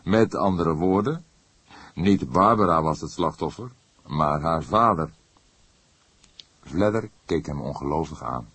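usual speaking voice

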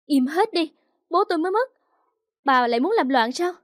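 high, loud, furious outburst; angry shout. A narrator's reading. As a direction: shout them down in anger. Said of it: "Tôi tức quá, hét lên".

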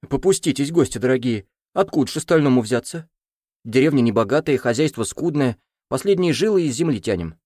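a touch of whining innocence; pleading village elder. Acted. (жалобный староста)

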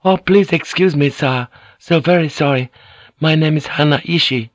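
high, deferential servant's voice; apologetic maid. A narrator's reading. Maid answers the samurai's question, high and apologetic. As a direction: apologise politely, pitched well up.

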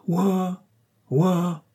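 high drawn-out call of a name. Acted. Toho calls his companion Wa, high ringing call. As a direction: call her from afar. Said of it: "Il appela".

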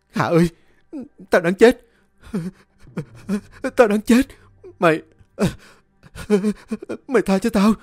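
high, loud pleading for mercy; terrified begging. A narrator's reading. Sử begs Hạ for mercy, terrified and pleading. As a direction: beg like your life depends on it. Sử begs ghost through tears.

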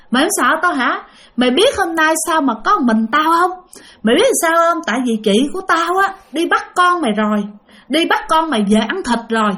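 a ghost taunting the man she blocked on the road. Retold or imitated. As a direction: gloat like a ghost with a threat.

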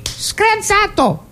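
very high, drawn-out female exclamation; woman's cry. Acted. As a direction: squeal it in the lady's voice. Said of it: "ma lei". (esclamazione acutissima e lenta della donna)

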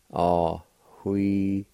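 Ahuidisca drawn out slowly with a faint H before hui; slow careful teacher model of Ahuidisca; slow demonstration. Shown as a demonstration. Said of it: "the faint H sound between the A and W".

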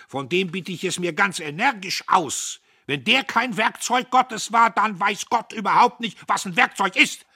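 high, very loud declamation; overbearing, indignant oratory; blustering patriot, emphatic. A narrator's reading. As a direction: hammer the argument home at full voice. Diederich declaims to the company.

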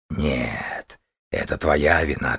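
low, soft accusing dragon; quiet blame from a beast. Acted.

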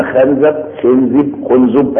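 خنزب as xemzab, xenzib, xunzub; three pronunciations of خنزب. Shown as a demonstration. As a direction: shift the vowels of خنزب each take. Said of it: "دي اسمها إيه؟ طريقة النطق". (خنزب بثلاث نطقات مختلفة)

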